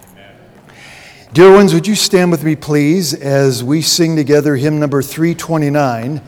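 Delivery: ordinary talk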